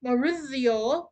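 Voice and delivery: slow, exaggerated Italian accent on "Maurizio"; drawn-out Italian accent, said with relish. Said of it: "I have a very bad Italian accent".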